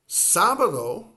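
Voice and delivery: Sábado punched high and loud on SÁ; stressed first syllable. Shown as a demonstration. Teacher announcing Sábado with a loud stressed first syllable. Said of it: "accent over the first A".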